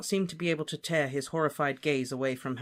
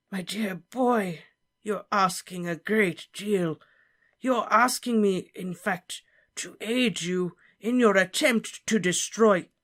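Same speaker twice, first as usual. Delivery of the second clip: put-on higher, slow pleading old professor; pleading Slughorn; 6 semitones higher, 4 dB louder, slower than usual. Slughorn protesting Harry's request. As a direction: protest gently, nervous.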